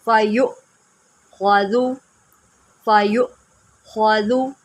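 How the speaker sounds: फ़यु with a sharp catch on the hamza; with a jerk. Shown as a demonstration. Teacher drills the hamza jerk in फ़यु for pupils. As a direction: jerk the voice on फ़यु, then ख्वाजु with heavy ख्वा. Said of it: "jerk देके पढ़ेंगे फ़यु".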